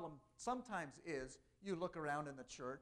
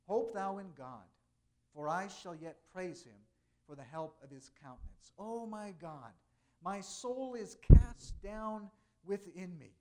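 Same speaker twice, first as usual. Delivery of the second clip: measured, louder psalm reading; solemn scripture reading; 10 dB louder than usual. Acted. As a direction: read the psalm with weight and space. Continues reading Psalm forty-two.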